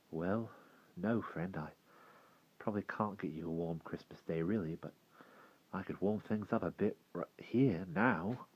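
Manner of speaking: soft, hushed reply; hushed, gently speaking boy; quiet boy's voice. Acted. Will speaks softly to the tree.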